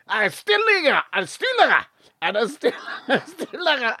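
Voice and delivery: high, garbled Scottish caricature; thick Scottish accent. Retold or imitated. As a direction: list the lagers in mangled Scots, breaking into laughter. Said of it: "had the same accent as the fella".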